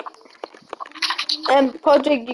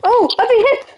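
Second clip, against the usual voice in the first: high-pitched startled cry; startled alarm; 8 semitones higher than usual. Real, not acted. Player struck in the game, startled alarm. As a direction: react to a sudden hit, pitch jumping up. Hit during game fight.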